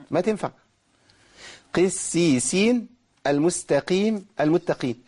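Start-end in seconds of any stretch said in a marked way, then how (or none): 1.7-5.0 slow, each qaf in قسيسين clearly a qaf; slow model of the kasra qaf. Shown as a demonstration. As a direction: say قسيسين المستقيم المتقين slowly, never like kaf. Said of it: "قافات واحدة مكسورة".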